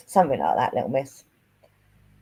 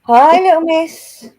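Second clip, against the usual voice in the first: high, slow, sweet greeting; high sing-song greeting; 9 semitones higher, 8 dB louder, slower than usual. Real, not acted. Greeting a viewer in baby voice.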